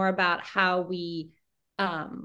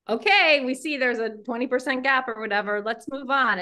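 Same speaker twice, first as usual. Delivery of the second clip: higher-pitched, brisk brush-off; mock dismissive; 4 semitones higher, 6 dB louder than usual. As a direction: imitate someone waving the problem away. Mimicking educators brushing past data.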